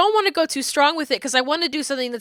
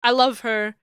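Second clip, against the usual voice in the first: ordinary talk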